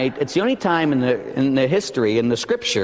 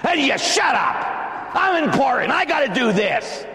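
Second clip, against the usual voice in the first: high, self-important shouting; mock indignant; 9 semitones higher than usual. Preacher mimicking self-important people brushing Jesus off, indignant shout.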